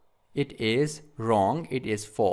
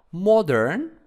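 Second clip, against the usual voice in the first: "Modern" with MOD hit louder and higher; stress on first syllable; 5 semitones higher, 6 dB louder than usual. Shown as a demonstration. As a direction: lean on the first syllable of "Modern", then let the end lift. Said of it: "on the first syllables".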